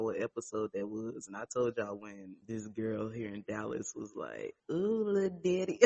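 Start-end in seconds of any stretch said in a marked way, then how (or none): 4.7-5.9 quoting it through a giggle; can't get the words out without laughing. Retold or imitated. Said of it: "I can't even say it without laughing and blushing".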